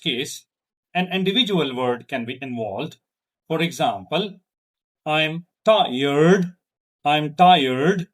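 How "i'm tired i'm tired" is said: loud, tired dipping and lifting back up; stressed tired with a down-then-up tune; fall-rise on tired. Shown as a demonstration. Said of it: "it shows the fall rise intonation".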